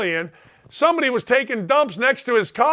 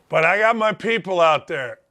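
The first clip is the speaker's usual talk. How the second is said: normal talking voice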